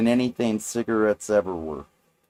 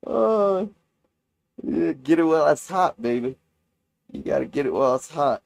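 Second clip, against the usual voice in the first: stoner; 5 semitones higher, 4 dB louder than usual. Real, not acted. Goofy hawking offer.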